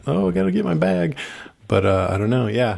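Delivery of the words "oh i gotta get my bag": mock whiny. Mocking quote of character.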